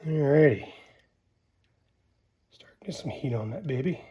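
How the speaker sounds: ordinary talk, nothing marked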